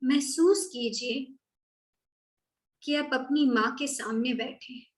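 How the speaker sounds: measured, unhurried meditation voice; guided meditation. Acted. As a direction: gently guide listeners through a visualisation, leaving space after each phrase.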